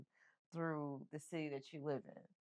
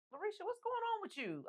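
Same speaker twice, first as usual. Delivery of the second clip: high, startled calling out; alarmed call. Retold or imitated. Neighbor seeing her bruised, a high startled call.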